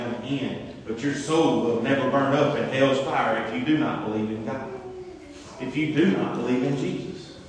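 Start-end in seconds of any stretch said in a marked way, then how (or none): none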